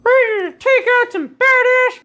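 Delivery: put-on squeaky high little-kid voice, way up; high cartoon kid hero voice; kid figure voice. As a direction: play a cocky little kid, pitched high. Kid Pool figure speaks.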